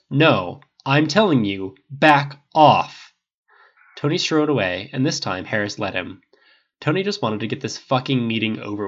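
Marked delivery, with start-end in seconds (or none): 0.1-3.1 loud, firm rebuff; angry warning. A narrator's reading. Tony cutting off Harris's apology, angry warning. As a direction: order him away forcefully.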